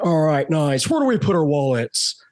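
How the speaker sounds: Nicolas Cage. Retold or imitated. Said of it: "he was like, he's like".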